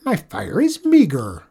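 high, loud, slow snap; snapping. Acted. Griselda turning away the traveller at her door, a high loud snap. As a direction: bite the words off at the stranger. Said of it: "snapped Griselda".

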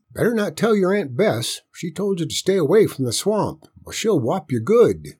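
high, lilting country grandpa; folksy granddad's caution; old grandpa, cautioning. Acted. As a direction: warn the boy of trouble coming. Voices grandpa warning boy.

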